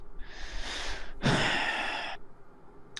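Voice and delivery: long breathy exhale; weary sigh. Acted. Barkeep sighs before answering the traveler, wearily. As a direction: let out a long, tired sigh.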